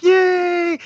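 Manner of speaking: very high, drawn-out mock cheer; hyped cheer. Dad celebrating his daughter's potty success, hyped high cheer. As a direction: replay your hype in a squealed celebration. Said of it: "hyped. I was like".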